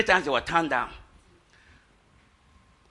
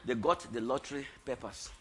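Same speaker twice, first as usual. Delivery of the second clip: much softer, low punchline; quiet; 3 semitones lower, 13 dB quieter than usual. Real, not acted. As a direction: drop it to a hush. Sermon punchline.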